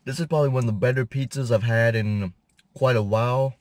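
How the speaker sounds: speaking with food in mouth; mumbled through a bite; talking while chewing. Real, not acted. Seen 2: Holds pizza, mouth still working on earlier bite while speaking.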